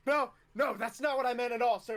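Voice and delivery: high, loud, hurried backpedalling; panicked protest. Acted. Recruit backpedals before the angry instructor, panicked. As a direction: scramble to placate a superior.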